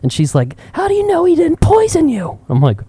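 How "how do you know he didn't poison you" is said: very high-pitched mock-outraged mother; hammed-up Karen mom question; imitated scolding mom. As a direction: demand it like an alarmed mom. Retold mom scolding her child.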